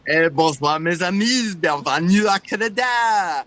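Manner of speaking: loud, hammed-up French Canadian accent. Performing for Holly on request, French Canadian accent. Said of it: "Can you do a French Canadian accent".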